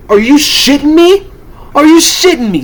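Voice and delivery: high, loud disbelieving outburst; are you kidding me, yelled high; outraged. Real, not acted. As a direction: shout it at the company in disbelief. Outraged rant at WWE booking.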